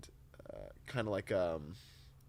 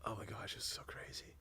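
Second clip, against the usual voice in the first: hushed awe; 8 dB quieter than usual. Real, not acted. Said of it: "whispered aside".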